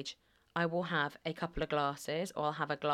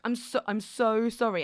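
higher, louder mock apology; over-apologise as if caught out; retold, hammed-up sorry; embarrassed apology; 5 semitones higher, 5 dB louder than usual. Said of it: "being embarrassed".

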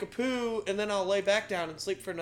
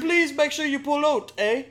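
high, loud mock-Canadian plea; hammed-up Canadian, pitched up; nice Canadian girl; 7 semitones higher, 7 dB louder than usual. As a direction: politely insist in a broad Canadian accent. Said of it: "Canadians are nice".

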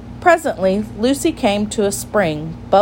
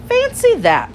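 very high, surprised storybook voice; acted astonishment, high then dropping; amazed exclamation; 3 dB louder than usual. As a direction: marvel at it, pitched right up. Tiggy Winkle exclaims at the find.

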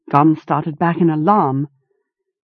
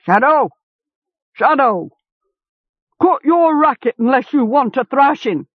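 farmer yelling; 8 semitones higher than usual. A narrator's reading. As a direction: bellow at the noisy hound from the door. Farmer yells at his dog.

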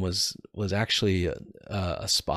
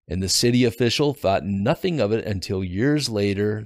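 ordinary talk

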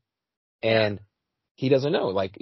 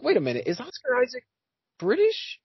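retold disbelief, pitched much higher; mock-baffled double take; high, questioning replay of surprise; puzzled surprise; 13 semitones higher than usual. Retold puzzled reaction to the actor.

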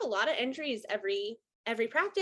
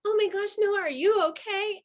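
worried cry; 8 semitones higher, 4 dB louder than usual. Retold or imitated. Retold concern for hit player.

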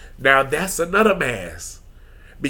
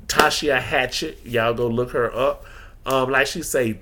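normal talking voice

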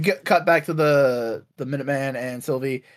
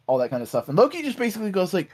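ordinary talk, nothing marked

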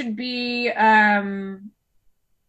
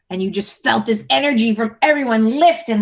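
ordinary talk

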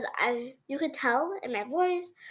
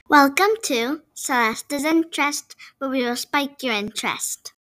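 podcast intro; 6 dB louder than usual. Acted.

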